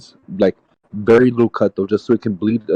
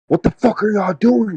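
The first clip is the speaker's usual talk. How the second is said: imitating the father's furious yell, much higher; mad dad yelling; 12 semitones higher than usual. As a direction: burst in and snap at the kids like an angry dad. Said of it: "he was so mad".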